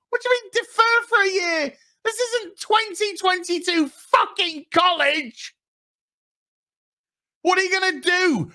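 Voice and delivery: high-pitched, squawking disbelief; exaggerated outrage, voice shooting way up; mock incredulous outburst. Challenging Isildur's wish to defer a year, shrieky mock disbelief.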